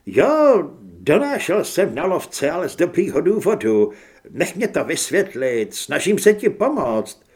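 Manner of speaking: loud, urgent confession; desperate pleading. A narrator's reading. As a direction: plead your case frantically at gunpoint. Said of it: "škemral Grant". (zoufalé, hlasité škemrání)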